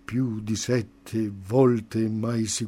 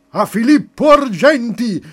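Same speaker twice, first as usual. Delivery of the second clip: urlato forte e acuto, a piena voce; grido di folla; 13 semitones higher, 16 dB louder than usual. Acted. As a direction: grida il nome come una folla inferocita. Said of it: "Tutti gridavano". (urlo della folla contro Filippo Argenti)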